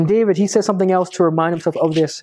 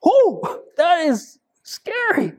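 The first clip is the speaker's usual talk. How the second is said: mock scared; 10 semitones higher, slower than usual. Mock fear at three bears.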